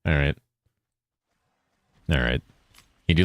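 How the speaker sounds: ordinary delivery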